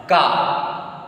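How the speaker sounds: "Ka" with a hard k, set apart; hard C sound. Shown as a demonstration. Teacher gives the rule before a consonant, hard "Ka". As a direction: isolate "Ka" as the hard sound of French c. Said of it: "se will be pronounced".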